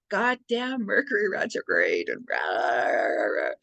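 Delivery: hammed-up frustrated grumble; imitating her own fuming at the computer; comic rah rah grumbling; mock rant.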